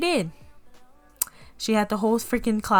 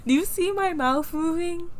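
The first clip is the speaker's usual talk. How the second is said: high, hammed-up disbelief; indignant retort; 8 semitones higher than usual. Pupil answering teacher who says she's silent, indignant retort. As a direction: repeat it in a high, incredulous mock protest.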